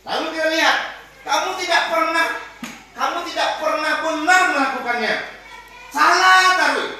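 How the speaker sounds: loud, high accusing scold; teacher demonstrating the punisher, loud; punishing teacher. Shown as a demonstration. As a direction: blame the pupil sharply. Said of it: "Maka apa? Ini penghukum".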